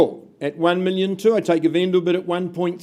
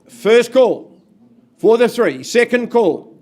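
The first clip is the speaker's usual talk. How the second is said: raised, ringing bid calls; loud auction call; 3 semitones higher, 5 dB louder, slower than usual. Real, not acted. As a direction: project each call across the room.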